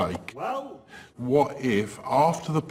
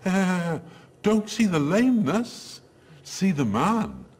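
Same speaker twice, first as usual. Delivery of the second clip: quoting the character's line; 7 semitones higher than usual. Retold or imitated. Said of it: "what he says is".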